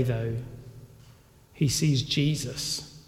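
usual speaking voice

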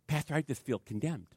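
soft, mildly dejected retelling; downcast churchgoer. Retold or imitated. Churchgoer confiding in pastor, quietly downcast. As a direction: imitate a downcast confession, kept quiet.

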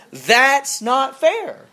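student silently fuming at a friend, mock yell; mock scream. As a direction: stretch it out like an inner yell. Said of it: "you're screaming at them in your head".

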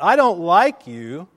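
loud, slow shout; boy yelling it into the valley; calling out. Retold or imitated. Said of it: "he called out".

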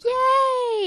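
high, drawn-out squeal of "yay"; SpongeBob cheering. Retold or imitated. Retelling SpongeBob's win to a co-host, high gleeful cheer. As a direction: celebrate in a very high, stretched cheer.

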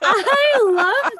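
laughing. Real, not acted. Touched by the flattering intro.

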